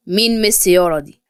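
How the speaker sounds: low, mildly puzzled father's question. A narrator's reading. Father asking his son who she is, puzzled. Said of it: "رد مراد عليه باستغراب".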